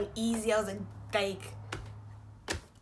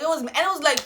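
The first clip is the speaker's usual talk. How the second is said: normal talking voice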